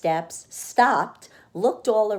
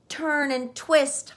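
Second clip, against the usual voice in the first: higher, slow honking goose; 7 semitones higher than usual. A narrator's reading. The goose honking at Wilbur. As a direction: honk the words out. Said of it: "honked the goose".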